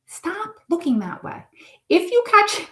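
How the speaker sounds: high, drawn-out admonishment; acted-out spirit guide telling someone off; stern guardian's warning. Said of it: "he's saying".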